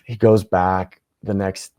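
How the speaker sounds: ordinary delivery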